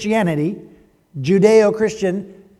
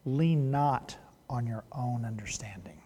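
whispered; 5 semitones lower, 12 dB quieter than usual. Acted. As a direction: breathe the proverb like a secret. Quoting a favorite proverb softly.

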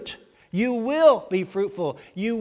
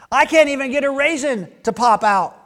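higher, louder whiny branch; confessing branch; 8 semitones higher, 7 dB louder than usual. Acted. Branch confessing to the farmer, higher and louder. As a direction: blurt out the frustration. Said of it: "one confessed".

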